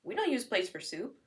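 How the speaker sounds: lightly voiced child calling it silly; a touch of a kid scolding Mommy; a hint of child voice. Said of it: "your kid at this point will be like".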